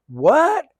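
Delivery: high, loud shocked shout. Retold or imitated. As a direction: blurt it out in disbelief. Mom shocked he's a clown.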